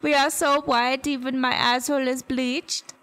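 light put-on Swedish accent; lightly hammed-up Swedish accent; mock Swedish accent. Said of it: "I sound Swedish".

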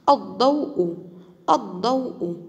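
الضوء with a heavy, emphatic ض; emphatic ض demo. Shown as a demonstration. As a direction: model الضوء twice, stressing the ض. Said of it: "حرف الضاد في وسط الكلمة". (نطق الضاد المفخمة في الضوء)

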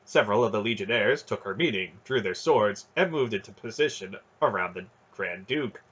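ordinary delivery

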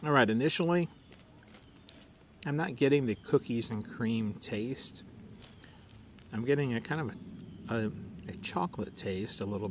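chewing between words; tasting while talking; mouth partly full. Real, not acted. Seen 0: Ate spoonful just before; closed-lip chewing between phrases while talking.

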